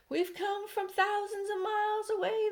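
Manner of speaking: high sing-song teasing call; centipede shouting back. A narrator's reading. Grinning centipede shouting down in a high sing-song. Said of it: "the centipede shouted back".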